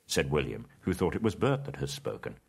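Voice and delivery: ordinary delivery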